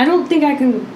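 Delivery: usual speaking voice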